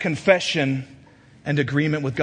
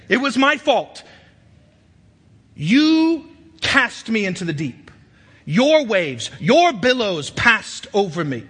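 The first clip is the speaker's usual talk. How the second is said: raised, impassioned preacher's voice; full-force preaching; 9 semitones higher, 6 dB louder than usual. Acted. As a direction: drive each phrase home, high and loud.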